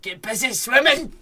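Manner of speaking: high, loud mangled film quote; mock impression. Co-host parodying the other's film quote, mock impression. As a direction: belt it out as a silly impression.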